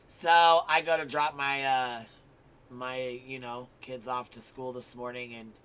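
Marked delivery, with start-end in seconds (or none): none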